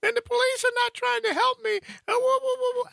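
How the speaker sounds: high falsetto sobbing wail, hammed-up; mock a panicked woman bawling down the phone; imitating hysterical crying. Scared passenger sobbing to her driver on the phone, high mock wail. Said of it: "it just boo-hooing".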